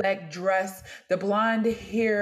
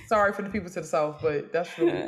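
normal talking voice

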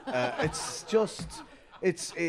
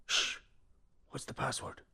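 whispering a secret password; 9 dB quieter than usual. Acted. Secret password exchange, hushed.

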